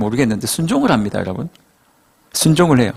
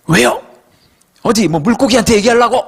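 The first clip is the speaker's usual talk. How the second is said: acted-out annoyed protest, higher voice; mock-irritated objection, pitched up; exasperated inner grumble; 7 semitones higher than usual. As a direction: grumble indignantly as the put-upon fisherman. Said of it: "짜증이 극치였어요".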